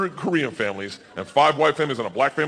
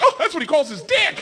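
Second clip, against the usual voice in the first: wife blurting; 13 semitones higher than usual. Retold or imitated. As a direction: blurt it out cheerfully.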